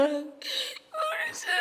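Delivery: very high, slow, tearful cry; a broken wail of the name; mother wailing. Retold or imitated. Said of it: "하면서 엄마가 그렇게 울었어요". (높이 울부짖는 엄마)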